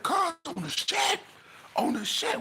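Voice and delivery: high, cracking plea; distressed, near crying. Retold or imitated. Shaken inmate swearing to his homeboy, voice high and cracking. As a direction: swear it like a man badly shaken.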